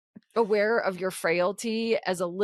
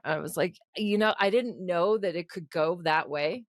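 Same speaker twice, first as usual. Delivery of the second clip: ordinary talk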